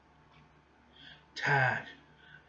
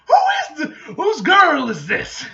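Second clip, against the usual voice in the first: much higher and louder, slowed on the question; shocked outburst; 13 semitones higher, 15 dB louder than usual. Acted. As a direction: recoil in disbelief, half laughing. Shocked reaction to rude girl.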